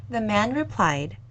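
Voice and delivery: ordinary delivery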